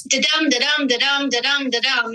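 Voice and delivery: sing-song da-DUM with the beat on each second syllable; light demo of the da-dum pulse; iambic da-dum rhythm. Shown as a demonstration. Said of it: "it's often taught, you know, it's".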